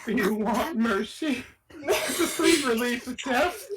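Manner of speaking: high, laughing offer of mercy; laughing mock offer. Player offering the old woman death, laughing. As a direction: ask it through laughter, high.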